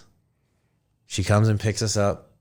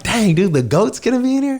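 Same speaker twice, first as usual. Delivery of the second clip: high, excited squeal; excited disbelief; 12 semitones higher than usual. Retold or imitated. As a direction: gush it like a thrilled fan. Excited about Tom Brady arriving.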